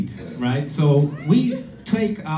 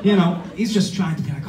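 ordinary talk, nothing marked

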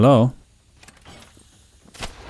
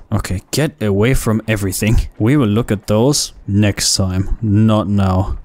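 usual speaking voice

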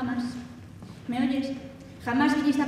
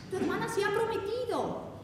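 high-pitched motherly announcement; imitating mother; 7 semitones higher, 3 dB quieter than usual. Mother tells elder sister of the engagement, imitated high voice. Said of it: "me dijo mamá".

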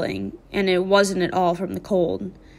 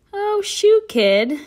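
sudden much higher, louder "Oh, shoot"; breezy dismissal; 13 semitones higher, 4 dB louder, much slower than usual. Acted. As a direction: brush off the apology lightly. Dally brushes off the apology.

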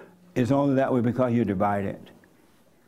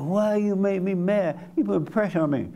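acted complaint; 7 semitones higher than usual. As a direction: complain in a higher voice, dragging it out. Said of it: "next time your husband makes you mad".

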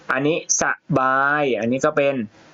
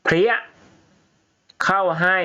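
slap sound; 7 semitones higher than usual. Shown as a demonstration. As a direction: crack out เพรี้ย as a sharp smack. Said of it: "คำนี้อ่านว่าเพรี้ย".

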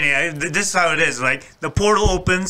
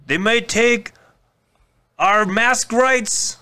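Braveheart speech; 7 semitones higher than usual. Retold or imitated. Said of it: "use, like, Braveheart".

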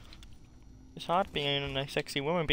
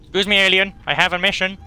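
loud excuse-me to a foe; loud announcing; 3 semitones higher, 11 dB louder, faster than usual. Pretend. Player excusing herself past the alien, loud announcing. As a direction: announce yourself loudly. Addressing alien while escaping.

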